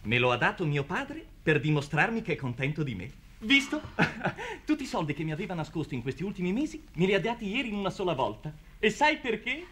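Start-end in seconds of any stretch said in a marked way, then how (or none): 3.4-9.7 higher, giggling, fast; laughing through the tale; laughing boast. Acted. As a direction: bubble over with boyish pride.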